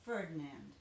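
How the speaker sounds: ordinary delivery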